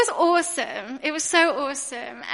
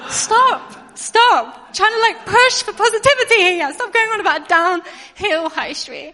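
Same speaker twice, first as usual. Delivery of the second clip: higher and louder, laughing while telling him off; exasperated laughing stop; 6 semitones higher, 7 dB louder, slower than usual. Retold or imitated. Wife telling husband to stop moaning about the high street, exasperated laughing.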